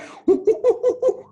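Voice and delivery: high bright burst of laughter; delighted laugh. Real, not acted. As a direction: laugh out loud with glee. Laughing at monkey sounds.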